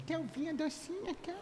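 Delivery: high, soft cooing uvinha docinha; sweet little voice. Shown as a demonstration. Telling your brother in that little voice, cooing. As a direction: coo it sweetly like to a child. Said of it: "com aquela vozinha assim".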